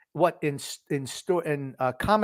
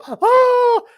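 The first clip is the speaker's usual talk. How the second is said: shocked cry; 9 dB louder than usual. Real, not acted. Reacting to the knockout.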